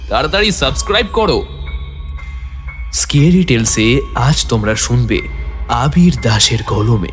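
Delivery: ordinary delivery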